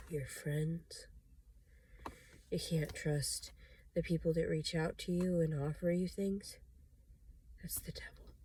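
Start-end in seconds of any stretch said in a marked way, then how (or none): 0.1-1.1 soft frightened whisper; fearful whisper. Real, not acted. Paranoid warning. 7.6-8.3 softer, hushed dread; fearful whisper. Real, not acted. Naming the devil in fear, hushed whisper.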